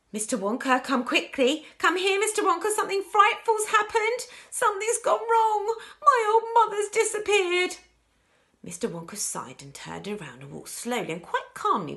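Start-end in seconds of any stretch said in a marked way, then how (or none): 0.1-7.8 high, loud frantic cries for help; panicked calling. Acted. Calling Mister Wonka as mother disappears, panicked shouting.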